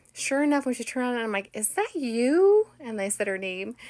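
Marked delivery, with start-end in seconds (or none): 1.5-2.7 high, loud, stretched rising question; excited squeal of a question; delighted recognition. Retold or imitated. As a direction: call it out in happy disbelief. Acts out recognising her friend.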